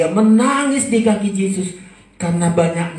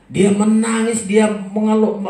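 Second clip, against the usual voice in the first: ordinary talk, nothing marked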